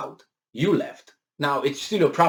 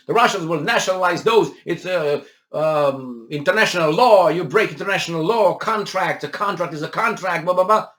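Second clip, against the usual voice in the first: mock outrage; 4 semitones higher, 4 dB louder than usual. Mocking Western outrage over Russia.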